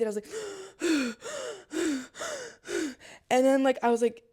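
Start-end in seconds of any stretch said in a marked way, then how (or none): 0.3-3.2 repeated high, gasping breaths; panicked gasping. Retold or imitated. Choker told to breathe by the woman, gasping. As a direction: imitate struggling to follow an order to breathe.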